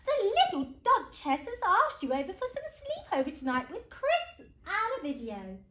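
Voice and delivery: high, loud announcement; bubbling storybook excitement, slowing at the end; thrilled mum. Acted. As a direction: break exciting news to a child.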